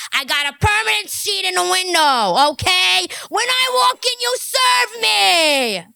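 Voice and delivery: loud, high, bossy demand. Acted. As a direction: bark the order, the last words very slow. Quoted rant clip, loud demand.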